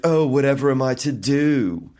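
imitating a British hotel clerk, put-upon and theatrical; British accent. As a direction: play a helpless English fella, hammed up. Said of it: "He's British as well".

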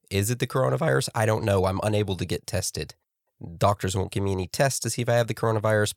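usual speaking voice

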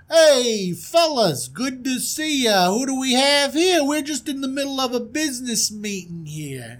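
high, loud hail to the fellas; hammed-up glad-handing host, high-pitched; brash prince. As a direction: greet them like a big-shot prince. Plays a story's brash prince.

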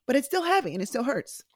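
holding back tears. Real, not acted. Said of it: "trying my best not to get emotional".

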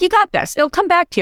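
high, bright mock encouragement; lightly voiced husband cheering her on; imitating husband. Host quotes husband cheering her on, light high mock voice. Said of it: "Matt's like".

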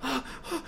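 two high, soft cries; high hushed mock screams; imitated sleep screams. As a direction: act out crying out in sleep. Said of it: "en la cama así de".

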